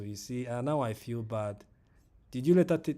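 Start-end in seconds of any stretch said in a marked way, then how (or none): 2.3-2.9 sudden loud, high alarm; startled real alarm, much louder; alarmed question. Real, not acted. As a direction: jolt up in disbelief.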